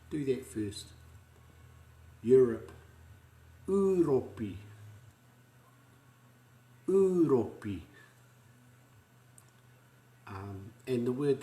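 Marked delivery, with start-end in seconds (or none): 3.7-7.8 "Uropi" oo-ro-pee, pitch raised, clear vowels; Māori pronunciation. Shown as a demonstration. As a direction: model "Uropi" for echoing. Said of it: "say it after me".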